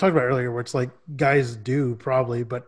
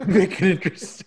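laughing; 6 semitones higher than usual. Real, not acted. Laughing repeat of the note.

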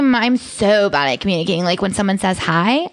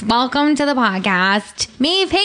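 announcer introduction; 4 semitones higher than usual. Real, not acted. Host welcomes Maeve Higgins to the podcast, grand announcer build. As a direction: announce the guest like a ringmaster, pitch soaring on the name.

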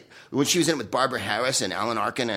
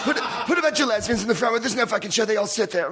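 mock Joan rant; 9 semitones higher than usual. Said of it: "she goes".

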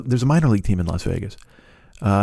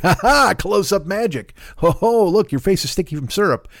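cheesy, higher magician showman voice, chuckling; Mac King; 8 semitones higher than usual. Retold or imitated. Showman magician teasing a breakfast diner, chirpy and higher. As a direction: ham up a chirpy stage magician, pitched higher.

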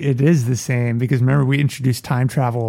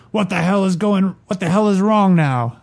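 growled; 6 semitones higher than usual. Acted. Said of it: "He growled".

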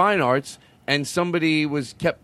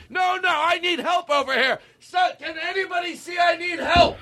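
much higher, pleading shout for help; mock cry for help; 12 semitones higher than usual. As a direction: beg the room to rescue you, hammed up. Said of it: "then go back to".

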